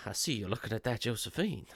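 put-on quiet, lightly drawled Western cowboy accent. Retelling Doc's line to co-host in a light Western cowboy accent. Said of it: "I can't stop doing the accent".

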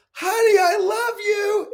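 high, drawn-out shout to another room; yelled goodbye. Retold or imitated. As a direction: call out long and high to your wife. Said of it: "I yelled her".

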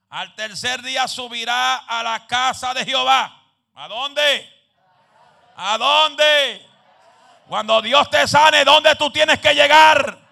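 high, loud sermon building to a shout; full-force preaching. Real, not acted. Preacher urging people back to God's house, full-force preaching.

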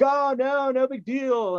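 high, airy no-big-deal brush-off; breezy dismissal. Retold or imitated. Brushing off a friend's offer to call for help, airy and high. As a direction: wave it away lightly. Downplaying injury to friend on phone.

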